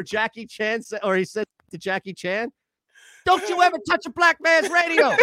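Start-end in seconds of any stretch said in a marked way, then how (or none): 3.2-5.2 high, loud laughing movie quote; Chris Tucker. Retold or imitated. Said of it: "remember the scene from Rush Hour".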